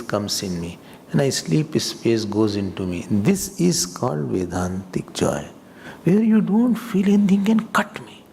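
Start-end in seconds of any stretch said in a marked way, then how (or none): 6.0-8.2 lifted higher with growing fervour; fervent. Real, not acted. Teacher to class on Vedantic joy, fervent and high. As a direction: rise up with conviction.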